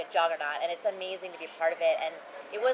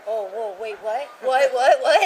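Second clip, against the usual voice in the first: stunned fan; 2 semitones higher, 8 dB louder, slower than usual. Retold or imitated. He's like.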